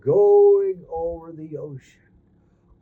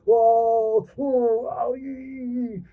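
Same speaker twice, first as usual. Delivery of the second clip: excited cries; 7 semitones higher, much slower than usual. Acted. Crab on storm-tossed boat.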